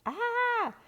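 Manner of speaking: short, very high frightened shriek. A narrator's reading. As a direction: scream like you saw a shark. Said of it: "shrieked Sue and Stephanie".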